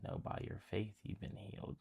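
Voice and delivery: soft, near-whispered blessing; Jesus gently tells the healed woman, softly; hushed Jesus. Acted. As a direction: breathe the words gently to her.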